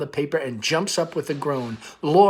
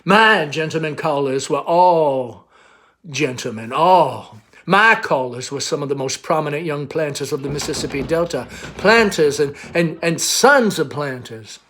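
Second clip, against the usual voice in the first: proud belle; 2 semitones higher, 7 dB louder than usual. Acted. Amanda voiced, slow.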